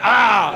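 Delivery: loud mock tiger "ah"; punchline tiger roar, loud; tiger roar imitated. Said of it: "just when I went".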